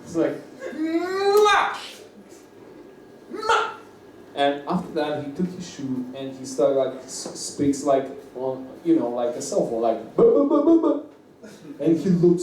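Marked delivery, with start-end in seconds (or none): 0.7-3.7 very high squealed "mwah"; piercingly high mock-mad yelp; imitated crazy outburst. Repeats crazy man's noise. 10.2-11.0 high, loud "buh-buh-buh" gibberish; rapid string of high nonsense syllables; imitated mad babble. Imitates man babbling into shoe phone.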